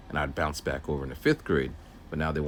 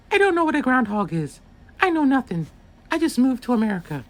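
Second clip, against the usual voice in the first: put-on much higher, louder little girl's voice; young student voice; 5 dB louder than usual. New student telling the aide she knows nothing, in a high girl's voice. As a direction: play the lost new kid from abroad, high and bright.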